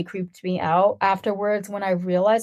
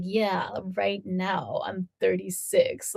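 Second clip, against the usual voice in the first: slow, softer, drawn-out retold reply; baffled slow answer; 6 dB quieter, much slower than usual. Retelling a reply to a repeated age question, slow and baffled. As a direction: spell it out slowly, at a loss.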